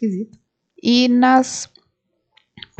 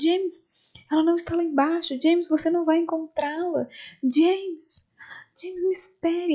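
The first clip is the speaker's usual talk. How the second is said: pleading call; 6 semitones higher, 4 dB quieter than usual. Retold or imitated. Maria limping, calling after James.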